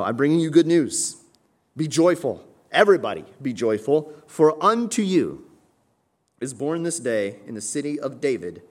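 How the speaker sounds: high, loud announcement; proclaiming scripture. Acted. Pastor reads the angel's words, loud and high. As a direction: ring it out like a herald.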